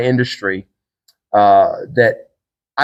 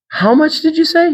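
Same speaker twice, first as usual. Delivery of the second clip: high, stunned "how much?"; shocked disbelief. Retold or imitated. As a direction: ask it like you can't believe your ears. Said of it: "it just shocked me".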